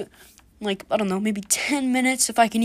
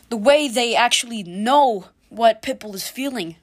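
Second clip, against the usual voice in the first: ordinary talk, nothing marked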